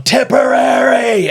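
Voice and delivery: big bellowing chant of Tipperary, high and slow; shouted county name. Retold or imitated. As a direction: yell it like a pub rival. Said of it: "this big guy was going".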